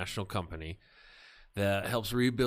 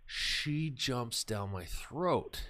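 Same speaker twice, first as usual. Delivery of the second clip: ordinary delivery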